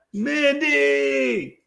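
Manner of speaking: high, drawn-out happy call; excited greeting. Real, not acted. Host welcoming Mindy onto the stream, calling out excitedly. Greeting a guest who appears.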